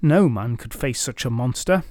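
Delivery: plain reading voice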